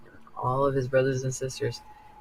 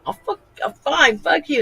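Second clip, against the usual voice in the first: higher, hammed-up angry snap; mock fury; 12 semitones higher, 4 dB louder than usual. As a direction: curse at the dog in playful fury. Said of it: "and I'm like".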